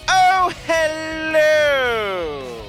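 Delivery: usual speaking voice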